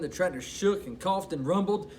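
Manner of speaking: ordinary delivery, nothing marked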